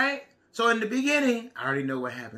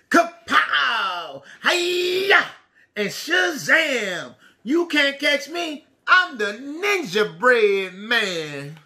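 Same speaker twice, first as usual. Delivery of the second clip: ninja battle shout; 3 semitones higher, much slower than usual. Acted. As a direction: shout kung-fu cries and tease the chasers. Refrain of ninja taunt.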